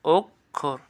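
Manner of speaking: "অক্ষর" as ওক্, pause, খোর; split pronunciation. Shown as a demonstration. Teacher quizzing students, "অক্ষর" in two clear pieces. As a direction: break "অক্ষর" after the k. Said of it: "কী হবে".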